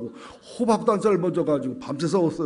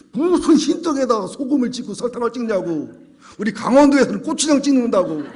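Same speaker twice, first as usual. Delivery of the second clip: higher, louder mock scolding judge; hammed-up judge scolding the couple; angry judge; 7 semitones higher, 7 dB louder than usual. Judge scolding quarreling couple in court, mock furious. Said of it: "판사가 막 화를 내며 그러더래".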